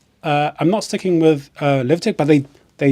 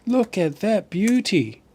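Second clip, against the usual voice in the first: put-on much higher, slow Australian accent; 7 semitones higher, slower than usual. Streamer retries his croc-hunter line, higher, slower Australian accent. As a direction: stretch it out in a high Aussie croc-hunter voice. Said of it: "I can't do the Australian accent so well".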